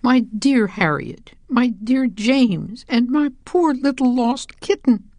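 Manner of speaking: slow, high, tender greeting; joyful mother. A narrator's reading. Mother cat greets her lost children, slow and tender. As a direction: welcome them back, nearly overcome. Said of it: "purring with joy".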